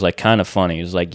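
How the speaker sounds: ordinary talk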